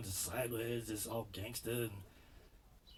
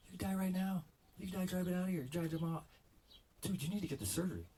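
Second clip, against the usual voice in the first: exasperated doctor; 10 semitones higher, faster than usual. Retold or imitated. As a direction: play the fed-up doctor scolding. Doctor scolding him in story.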